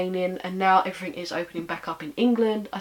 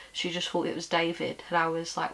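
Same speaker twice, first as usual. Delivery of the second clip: ordinary delivery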